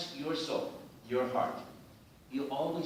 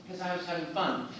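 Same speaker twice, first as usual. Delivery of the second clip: normal talking voice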